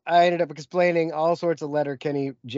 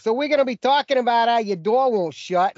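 YouTube tutorial guy; 7 semitones higher, 4 dB louder than usual. Retold or imitated. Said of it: "this guy's like".

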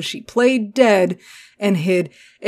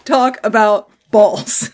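slow announce; 2 semitones higher, 5 dB louder, slower than usual. Real, not acted.

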